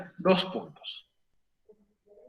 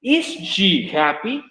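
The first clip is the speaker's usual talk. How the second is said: pregunta lenta y marcada; much slower than usual. Shown as a demonstration. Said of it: "ahora lo pasas a pregunta".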